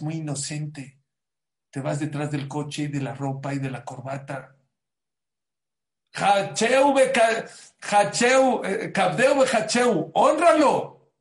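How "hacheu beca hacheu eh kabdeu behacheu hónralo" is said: loud, high declamation of the verse; loud declaiming. Acted. Preacher calls out the verse, loud and high. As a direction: cry out the verse, peaking on hónralo.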